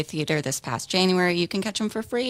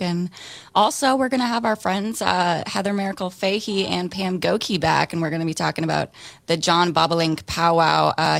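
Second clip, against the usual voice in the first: normal talking voice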